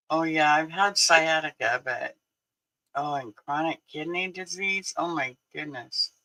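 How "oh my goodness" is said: ordinary delivery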